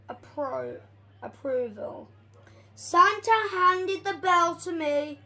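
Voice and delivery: plain reading voice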